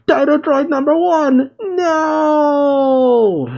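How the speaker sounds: high, wailing cry held long on the last word; hammed-up anguished shout. Doctor Hedo sees his robot destroyed, theatrical wailing shout. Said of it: "Hedo shouts".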